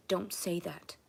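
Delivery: whispered cold rebuke; retold rebuke. The mean officer shutting her down, whispered. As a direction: hiss it quietly, hard and mean. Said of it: "And she said".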